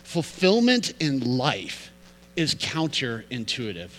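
Fulfillment in life, slow and deliberate; slow, weighty repeat of the theme; slow emphatic point. Shown as a demonstration. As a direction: lay the main point down slowly. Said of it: "key point repeated slowly".

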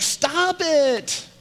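high, held-out stop it. Retold or imitated. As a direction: stretch the command up high. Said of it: "Jesus walks in and he's like".

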